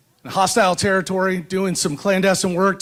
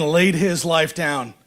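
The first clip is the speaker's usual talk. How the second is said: podium speech. Acted. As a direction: stir up the crowd from the podium. Rousing speech to rally crowd.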